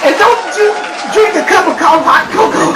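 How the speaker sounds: high, loud comic threat; sputtering outrage. Acted. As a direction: warn him in a strained holler. Sputtering warning after foul coffee.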